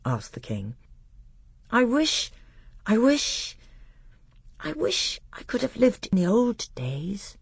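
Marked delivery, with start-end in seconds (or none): 1.7-7.4 put-on high, wistful little boy; hesitant child confiding a wish, halting between tries; small, dreamy boyish voice; Caspian. He was only a very little boy.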